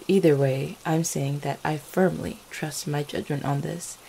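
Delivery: ordinary delivery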